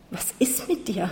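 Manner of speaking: soft, slow stammer. A narrator's reading. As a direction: stammer it out in shock. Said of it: "hat er gestammelt". (leise, langsam stammelnd)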